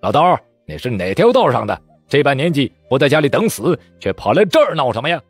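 mild mock yell, rising; angry shout. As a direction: shout him down. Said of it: "怒喝道".

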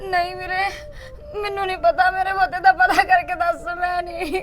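speaking through tears; frightened mother, near sobbing; crying. Acted. Sister telling her brother about the call, crying.

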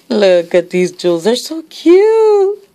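delighted gush climbing sharply on "so cute". Real, not acted. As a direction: gush over something adorable. Gushing over a gift.

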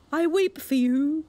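hammed-up crocodile tears; weeping walrus. A narrator's reading. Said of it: "With sobs and tears".

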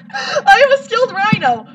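sung through laughter; laughing. Real, not acted. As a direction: laugh while singing it.

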